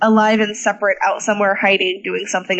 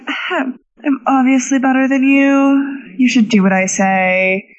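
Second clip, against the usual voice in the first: drawn-out smug superiority, ending on a louder jab; 3 dB louder than usual. Acted. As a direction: act insufferably superior, stretching the words. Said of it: "she just has this smug".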